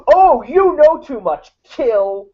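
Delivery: cartoon villain. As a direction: bark the order like a cartoon baddie. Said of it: "cartoonishly so".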